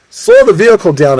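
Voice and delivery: high, loud command; acted urgency in a chase; urgent order. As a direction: call the order to the driver. Order to driver during chase.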